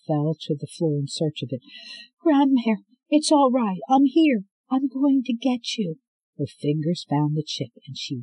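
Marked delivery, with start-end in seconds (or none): 2.2-6.0 higher, loud urgent reassurance; anxious young woman. Acted. Scarlet finding her grandmother in the cell, anxious and urgent. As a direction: rush to calm her with your voice.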